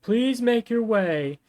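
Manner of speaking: high, slow official announcement; megaphone announcement. Acted. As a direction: announce it slowly through a megaphone. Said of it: "a megaphone behind the back".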